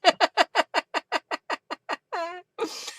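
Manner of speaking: high, rapid run of giggles; long laughing fit. Real, not acted. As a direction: laugh helplessly at a joke. Helpless laughter at a joke.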